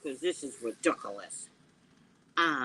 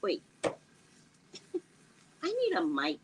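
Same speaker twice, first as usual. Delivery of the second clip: ordinary delivery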